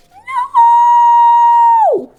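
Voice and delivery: very high, loud drawn-out no; anguished shout. Real, not acted. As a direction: wail the no in despair. Can't find the S piece.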